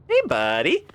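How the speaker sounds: loud, high call to a critter; big high-pitched greeting; playful hail. Pretend. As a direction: call out high and loud, like hailing a little buddy. Greets a creature in the game.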